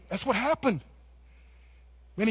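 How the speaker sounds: ordinary talk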